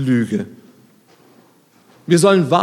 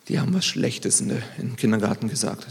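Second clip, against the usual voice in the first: soft, half-whispered imitation of a child; hushed confiding; 4 semitones lower, 8 dB quieter than usual. Father retells daughter's report from kindergarten, hushed and confiding. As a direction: imitate a child telling on others under the breath.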